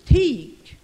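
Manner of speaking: loud, high one-word order; sharp command. Acted. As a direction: order them to shut up, loudly. Acting out orders to silence.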